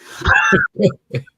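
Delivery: loud high burst of laughter; real loud laugh. Real, not acted. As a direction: crack up at the punchline. Laughs after punchline.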